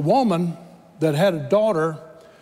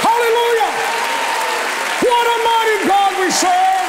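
preaching at full force; 13 semitones higher than usual. Acted. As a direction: lift the congregation, shouting praise.

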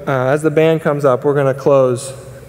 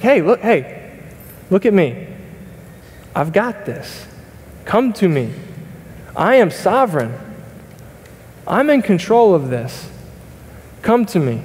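gentle voice of God; 4 semitones higher than usual. Acted. Preacher voicing God, calling the anxious believer. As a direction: invite them tenderly, come to me.

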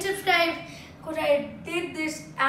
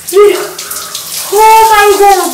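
screaming from cold; 2 semitones higher, 16 dB louder than usual. Real, not acted. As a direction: yell in shock at icy water. Sitting in freezing cold bath.

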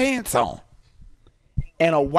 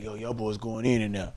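low murmur; 9 semitones lower, 9 dB quieter than usual. Retold or imitated. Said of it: "looking like".

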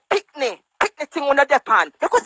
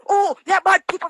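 mocking quote; 6 semitones higher than usual. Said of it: "this tactics of".

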